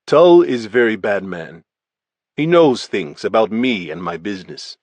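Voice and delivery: a hint of a Russian accent, blunt and even; lightly voiced Russian gangster, matter-of-fact; Russian gangster giving his statement, lightly accented. Volkov's recorded statement.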